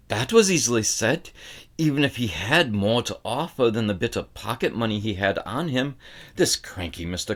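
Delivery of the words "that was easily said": ordinary delivery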